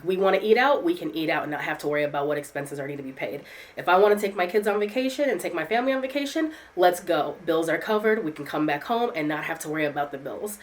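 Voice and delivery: usual speaking voice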